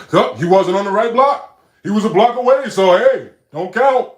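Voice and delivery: loud, high, sneering parody of a nitpicker; mocking the critic. Streamer mocking a chat troll's nitpick, sneering mock voice. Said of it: "so I could try to say later".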